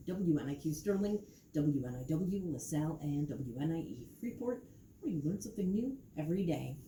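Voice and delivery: smooth on-air station ID read; radio announcer. Acted.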